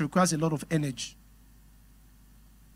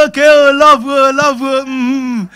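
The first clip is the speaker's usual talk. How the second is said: higher, much louder hammed-up cooing of "oh, love"; mother giving in; 8 semitones higher, 13 dB louder, slower than usual. Mother accepting that the wife comes first, loud mock coo. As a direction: play the doting mother melting at her son's words.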